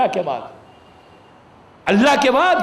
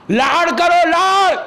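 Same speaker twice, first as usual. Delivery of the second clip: fervent urging; 5 semitones higher, 4 dB louder, slower than usual. Real, not acted. Preacher urging fond love for Imam.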